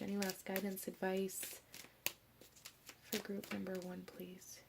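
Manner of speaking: soft whispered request to the cards; whispering a gentle please; hushed plea. Real, not acted. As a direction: breathe the question out quietly. Whispered plea to her shadow self.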